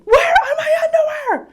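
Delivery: very high, loud half-laughing shriek; child's scream. Retold or imitated. Mom imitating daughter screaming for underwear. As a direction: shriek it in full meltdown. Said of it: "she screamed".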